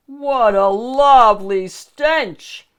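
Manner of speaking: loud, slow, gloating ogre delight; big hammed-up ogre cackle; gleeful cackle. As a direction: savour the stench with a cackling relish. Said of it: "Shrek cackled".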